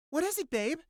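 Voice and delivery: put-on high-pitched girlfriend voice; woman's voice. Woman to boyfriend hearing rustling, high questioning voice. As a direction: ask it high, in a put-on female voice. Woman answers her startled partner.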